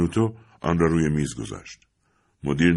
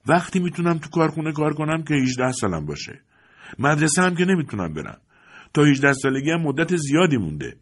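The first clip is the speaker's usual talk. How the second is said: frustrated retort; 7 semitones higher than usual. Acted. Son argues back at father.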